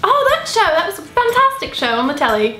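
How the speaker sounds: gushing posh British, starting high; posh British accent. Pretend. Answering about a telly show, posh British accent. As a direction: enthuse in mock posh British. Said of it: "British posh".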